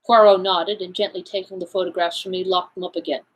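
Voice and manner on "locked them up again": usual speaking voice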